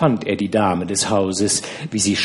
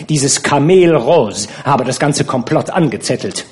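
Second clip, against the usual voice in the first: usual speaking voice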